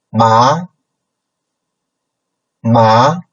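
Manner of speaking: MA sliding upward; second tone. Shown as a demonstration. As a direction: lift the pitch steadily through MA. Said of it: "So the first one is second tone".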